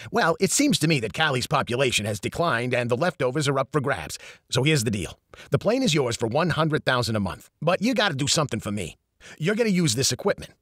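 put-on brash New York accent; a fast-talking New York accent, lightly voiced. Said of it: "Eddie spoke with a strong New York accent".

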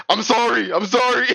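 high-pitched acted-out apology; mock apology. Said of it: "he's like".

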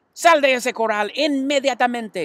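high, loud scolding order; mother shouts. A narrator's reading. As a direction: mom yelling at her boy. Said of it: "gritó la señora Arable".

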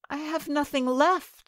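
put-on high, hopeless young girl; despairing maiden. A narrator's reading. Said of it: "said she".

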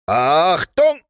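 drawn-out high, loud "Achtung"; parade-ground call to attention; sergeant commanding. Acted. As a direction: bark the command with a rising end. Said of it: "kommandierte der Wachtmeister".

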